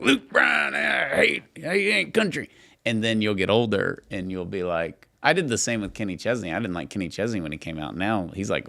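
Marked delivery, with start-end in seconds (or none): 0.0-2.5 put-on grumpy old man. Host imitates co-host's future self griping about a singer, grumpy old man shout. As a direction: holler it like a cranky old man. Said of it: "do the grumpy old man thing".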